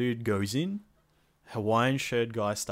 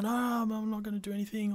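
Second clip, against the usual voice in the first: much higher and fast, hammed-up pleading; mock panic; 11 semitones higher, faster than usual. Pleading with robbers holding his mate, high and fast. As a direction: beg the robbers not to shoot, half-joking. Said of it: "and I was like".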